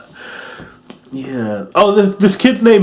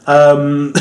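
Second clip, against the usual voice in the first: ordinary talk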